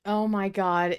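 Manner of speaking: ordinary talk, nothing marked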